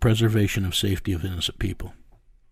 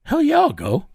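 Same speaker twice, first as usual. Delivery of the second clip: much higher, jumping at the offer; eager yes; 3 dB louder than usual. Retold or imitated. Partner accepting the paid job, excited whoop. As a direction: blurt it out, thrilled.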